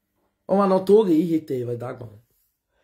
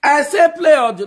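louder, higher, heated call; heated shout; 8 semitones higher, 5 dB louder than usual. Real, not acted. Livestream host shouting back at his rival, heated. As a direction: fire back hard in the quarrel. Quarrel on live stream.

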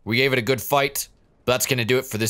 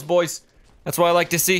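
normal talking voice

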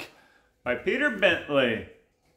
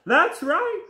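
high, piping agreement; squeaky mock cry; crying cod; 4 dB louder than usual. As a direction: pipe up as a frightened cartoon cod. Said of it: "cried the cod".

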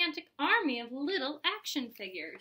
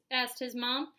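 ordinary delivery, nothing marked

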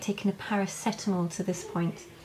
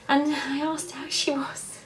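emotional; 6 semitones higher, slower than usual. Real, not acted. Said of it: "it's quite emotional, sorry".

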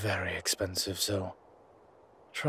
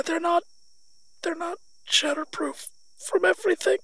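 much higher and louder, breathless and desperate; gasping for breath; 8 dB louder than usual. Acted. Suffocating, he begs the little one to leave with him. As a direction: squeeze out each word as the air runs out. Suffocating, calling the child over.

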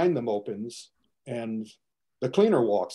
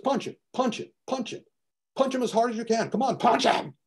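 high, insistent coaching, pitched up; urging on; 9 semitones higher than usual. Retold or imitated. Custodian coaching the girl to hit the pusher, high and urgent. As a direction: egg her on, higher each time.

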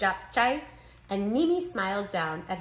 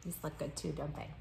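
plain reading voice

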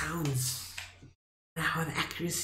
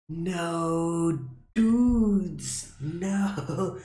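dismayed cry; 4 semitones higher, 4 dB louder, much slower than usual. Real, not acted. Complains to viewers after dying.

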